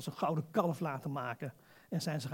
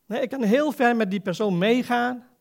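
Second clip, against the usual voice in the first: ordinary delivery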